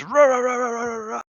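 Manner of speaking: loud roaring rah rah; monster battle cry. Acted. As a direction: bellow each rah like a brute. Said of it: "And he goes".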